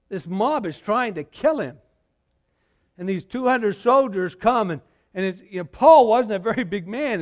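high, loud and urgent, building as the mob closes in; excited retelling. Real, not acted. Preacher to congregation, reliving the riot, excited and loud. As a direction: paint the chaos with rising energy.

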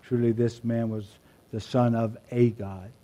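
"a" lifted and stretched, "Son of a God"; stress on a. Shown as a demonstration. Preacher contrasting translations, stress on "a". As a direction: lean on the word "a" in "Son of a God". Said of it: "This can be translated".

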